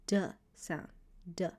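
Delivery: hard D as in dog; D sound. Shown as a demonstration. Teacher demonstrating the Hebrew D sound, crisp. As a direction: say D crisply, a plain stop. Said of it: "Dalet makes the D sound".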